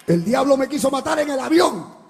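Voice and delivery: loud, high preaching cry over the music; fiery preaching. Acted. Preacher crying out the plane story to the church. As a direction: preach it out to the congregation, full force. Preaching testimony at full force.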